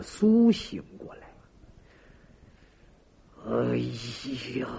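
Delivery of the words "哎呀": low, soft groan of pain; weak waking groan. A narrator's reading. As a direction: groan faintly as you come round from a faint. Tortured man slowly comes round.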